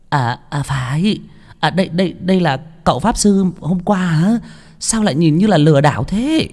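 squint at the stranger in doubt; doubtful woman. Acted. Woman character voice. (giọng đàn bà cao, ngờ vực)

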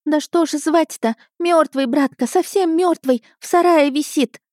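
put-on high-pitched childish wailing; boy putting on tearful distress; whimpering boy. A narrator's reading. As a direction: whine it out like an upset lad. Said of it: "захныкал он".

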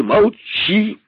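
король обрывает резким окриком; громкое, повелительное «Молчи!»; крик. Acted. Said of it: "крикнул король". (король кричит на находчивого, громко и властно)

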